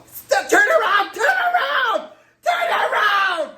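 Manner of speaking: high, loud, laughing shout; frantic shouted warning. Retold or imitated. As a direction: yell it like a panicked friend. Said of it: "her friend was like".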